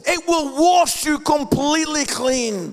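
very loud, high shouted "wash you completely clean"; shouted peak. Shown as a demonstration. Pastor at sermon's peak, high shouted proclamation. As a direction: cry it out at the top of the climax. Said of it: "repeated line".

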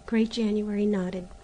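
plain reading voice

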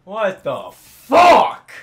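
very loud, very slow outrage; stunned furious yell. Real, not acted. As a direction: stretch it into a loud roar. Gamer fails again.

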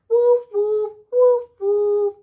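loud barking woofs like a dog; loud playful woofs for the kids. Shown as a demonstration. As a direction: bark it out like a big dog. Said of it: "that's how the dog sounds".

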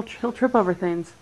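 usual speaking voice

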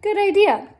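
ordinary delivery, nothing marked

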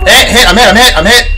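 urgent yells of being shot; man down shout. Real, not acted. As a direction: call out you're hit, loud and repeated. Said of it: "he got you good".

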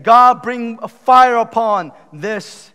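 loud, high, drawn-out plea; imitated shouting. Prophets begging their god for fire, shouted. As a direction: cry out slowly and loudly to a god. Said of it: "they are screaming, they're shouting".

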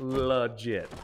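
"Legit" in Appalachian twang, vowel of "le" reduced to "luh-JIT"; Appalachian drawl on "Legit". Shown as a demonstration. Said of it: "That's the Appalachian way to say legit".

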